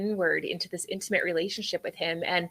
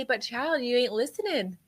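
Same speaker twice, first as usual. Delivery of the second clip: God gently scolding; 6 semitones higher than usual. Retold or imitated. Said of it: "slowed me down enough to say".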